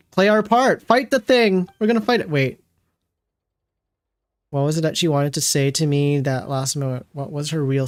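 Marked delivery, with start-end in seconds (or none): 0.1-2.3 excited. Real, not acted. Hyped reacting to boss fight.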